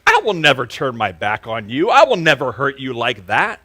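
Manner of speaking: loud, earnest promise; confident vow. Retold or imitated. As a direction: proclaim it like you mean it forever.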